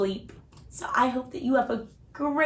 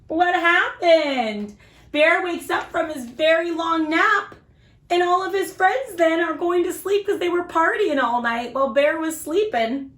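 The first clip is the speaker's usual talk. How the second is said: usual speaking voice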